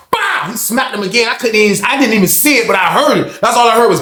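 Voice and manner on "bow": smack sound. Retold or imitated. Imitating the second smack.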